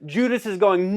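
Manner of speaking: ordinary talk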